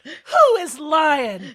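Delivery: slow booming announcer tease; dramatic announcer. Acted. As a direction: drag it out like a mystery host. Dramatic mock news tease.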